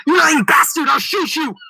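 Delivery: loud, high furious threat; angry yell. A narrator's reading. Marquis aiming his musket at the Earl, yelling. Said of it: "Marquis yelled".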